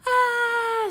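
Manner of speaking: high loud scream of fright; sudden high panicked scream. Acted. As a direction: shriek like you're being chased. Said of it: "screamed Lightning".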